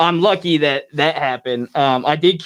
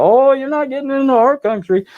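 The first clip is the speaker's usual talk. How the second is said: put-on high, bright-toned Canadian border agent accent; 11 semitones higher, 4 dB louder than usual. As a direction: mimic the Canadian customs lady, higher and louder. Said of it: "I don't mean to use the Canadian accent".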